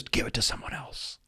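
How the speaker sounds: ordinary delivery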